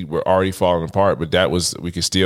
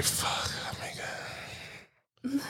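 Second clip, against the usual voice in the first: breathless, drawn-out whisper; shocked whisper; 17 dB quieter, much slower than usual. Retold or imitated. Getting the slow hit, awed slow whisper. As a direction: breathe it out slowly in disbelief. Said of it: "And I'm like".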